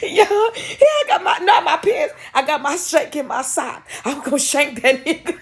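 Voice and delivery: high, laughing, tearful retelling; barely holding it together while quoting; crying with laughter. Retold or imitated. Quoting dad about shank, crying with laughter.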